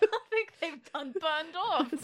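high slow Cockney voice; Cockney accent. Retold or imitated. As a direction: drag it out like a singed Londoner. Voice of the singed Londoner.

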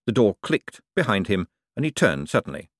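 ordinary delivery

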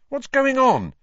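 loud, high-pitched startled question; alarmed cry. A narrator's reading. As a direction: call out in alarm in the dark. Startled cry in sudden darkness.

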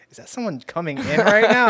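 high, loud startled question; sudden disbelief; surprised. Retold or imitated. Surprised self-quote.

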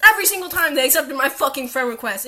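ordinary talk, nothing marked

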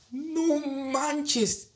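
a high, shocked gasp of a phrase; stunned exclaim. Retold or imitated. Seeing thousands of crosses by the river, stunned and high.

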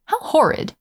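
understated cry of disgust; sudden cry. A narrator's reading. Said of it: "He suddenly cried".

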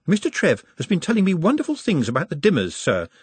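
polite, high-pitched chatter; chattering Nutt. A narrator's reading. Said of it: "while Nutt chattered".

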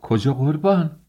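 high, alarmed question; startled, voice leaping upward; flustered. A narrator's reading. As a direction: call after him in a fluster. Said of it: "دست پاچه شد و گفت".

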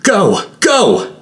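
high, urgent yell; urgent shout. A narrator's reading. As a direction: shout it high, urging them to flee. Character urging an escape.

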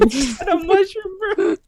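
high squeal through laughter; cracking up mid-question; laughing. Real, not acted. Laughing at shared picture.

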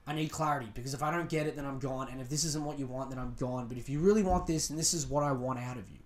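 low, quiet and controlled; calm, firm ultimatum. Shown as a demonstration. Girlfriend answering a boyfriend who flips out, calm and firm. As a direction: state terms quietly without losing your cool. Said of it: "I would just be like".

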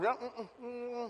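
imitated muffled "mm, mm" with lips shut; high, soft hum through a closed mouth; closed-mouth humming. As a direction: mimic someone refusing to open their mouth. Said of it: "Some people will close their mouth".

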